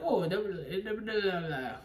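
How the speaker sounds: put-on jaunty accent imitation; lightly jaunty nonsense chatter; mock lively, jaunty. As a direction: bounce through it as a lively mimic. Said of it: "lively and jaunty".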